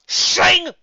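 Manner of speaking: high, loud sword sound; sword shing. Acted. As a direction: make the blade ring.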